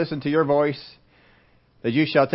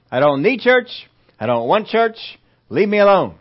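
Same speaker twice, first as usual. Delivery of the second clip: raised, high-pitched protest; defiant refusal; 5 semitones higher, 4 dB louder than usual. Acted. Churchgoer fed up with invitations, loud and defiant. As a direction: push them away loudly.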